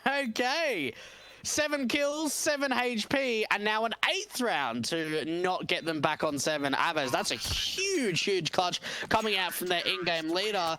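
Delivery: high, amazed caster reaction; excited. Real, not acted. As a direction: marvel at the clutch. Caster reacting to huge clutch.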